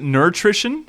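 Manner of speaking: "nutrition" with an added R, NUR-trition; hypothetical mispronunciation. Shown as a demonstration. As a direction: ask it loud, "nutrition" as nur-TRISH-un. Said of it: "Have I been saying that wrong".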